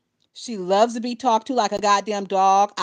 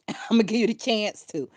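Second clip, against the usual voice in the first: usual speaking voice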